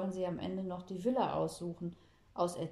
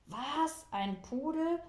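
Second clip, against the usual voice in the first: higher, startled disbelief; shocked; 7 semitones higher, much slower than usual. A narrator's reading. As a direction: gasp it out in alarm. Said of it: "fällt vor Schreck fast vom Klosett".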